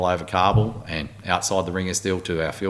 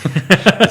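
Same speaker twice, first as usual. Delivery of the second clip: short high laugh, louder; high burst of laughter; 13 semitones higher, 11 dB louder than usual. Real, not acted. Amused by the German captain's one-word answer, high burst of laughter. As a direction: let a quick high laugh out.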